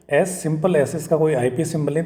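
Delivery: ordinary delivery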